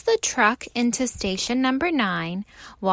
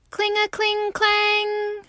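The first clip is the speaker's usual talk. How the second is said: high sung bell chime; ringing bell; 9 semitones higher than usual. A narrator's reading. Narrator ringing the alarm bell. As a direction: ring it like a bell. Bell sound in picture book.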